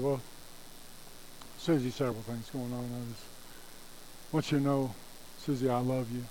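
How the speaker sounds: soft, low, halting voice; understated distress. Acted. Distressed dad telling Susie he loves her, softly holding back tears. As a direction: hold back tears, pausing between phrases. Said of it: "kind of in distress a little bit".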